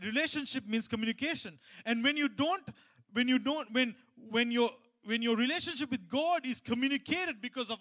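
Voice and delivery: high, loud pulpit delivery; loud, high-pitched preaching; preaching at full force. Real, not acted. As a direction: drive the point home to the congregation.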